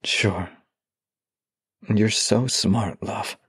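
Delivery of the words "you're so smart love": ordinary talk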